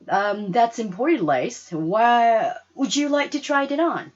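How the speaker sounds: saleswoman. Acted. Saleswoman offers try-on.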